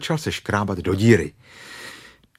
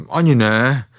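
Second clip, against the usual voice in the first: louder, higher casual denial; feigned nonchalance; 4 semitones higher, 7 dB louder than usual. Acted. Stuck bear denies being stuck, feigned nonchalance. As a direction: brush it off as if nothing happened. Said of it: "řekl Pů jakoby nic".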